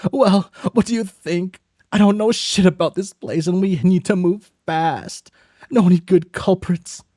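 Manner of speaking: a hurried, nervous accomplice pushing to act; brisk high character voice. Acted. A nervous partner urging the other to deal with the body, high and fast.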